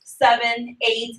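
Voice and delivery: ordinary delivery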